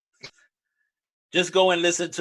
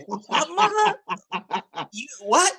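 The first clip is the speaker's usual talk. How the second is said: much higher, incredulous squeal; outraged disbelief; 7 semitones higher than usual. Retold or imitated. Cohost retelling yelling at the TV, outraged disbelief. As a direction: react like you can't believe your ears. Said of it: "I'm like".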